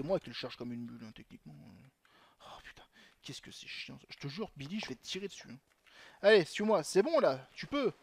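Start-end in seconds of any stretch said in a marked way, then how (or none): none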